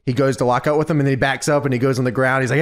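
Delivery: ordinary talk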